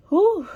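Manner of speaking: high, loud relieved exhale; loud shaky whew; big whew. Real, not acted. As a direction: blow out the emotion. Emotional release breath.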